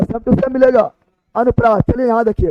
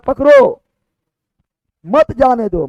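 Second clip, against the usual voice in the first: "पकड़ो", long pause, "मत जाने दो" as one group; pause after पकड़ो; slower than usual. Shown as a demonstration. Teacher demonstrating the comma to students, pause after पकड़ो. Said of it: "comma पर depend करेगा".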